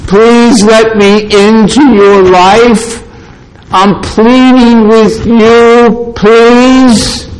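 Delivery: imitation of a needy, pleading Lord; mock begging. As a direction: plead in caricature, stretching each phrase. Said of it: "This is no Lord begging".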